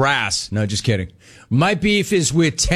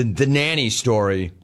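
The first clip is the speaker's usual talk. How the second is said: ordinary talk, nothing marked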